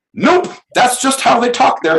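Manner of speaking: high, loud brushing-off voice; assistant's reply. Retold or imitated. The assistant dismissing his worry about the caller in a high loud voice. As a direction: toss it off like a matter-of-fact assistant. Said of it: "talked to his staff".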